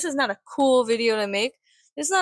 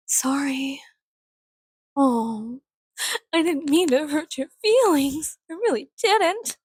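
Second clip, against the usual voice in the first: higher, put-on sweet sorry; mock apology; 4 semitones higher, slower than usual. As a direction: fake concern, dripping sarcasm. Fake apology to trolls.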